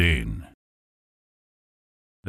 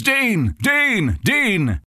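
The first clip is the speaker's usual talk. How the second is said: urgent battlefield calls; 11 semitones higher, much slower than usual. Acted. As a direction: shout for him over the gunfire. Troops calling under fire.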